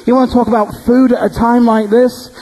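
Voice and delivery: high, angry shouted retort; retold angry shout. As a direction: yell at him in disbelief. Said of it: "I started shouting at him".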